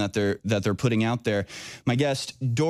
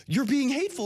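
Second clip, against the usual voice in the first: much higher, whiny mock outrage; mock freak-out; 12 semitones higher than usual. Host mimics offended people freaking out, high mock whine. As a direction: parody an offended person losing it. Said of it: "they freak out and they go".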